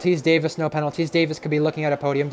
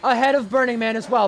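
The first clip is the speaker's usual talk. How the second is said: motorsport commentator; 8 semitones higher than usual. Real, not acted. Live race play-by-play.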